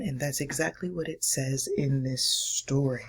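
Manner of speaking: ordinary talk, nothing marked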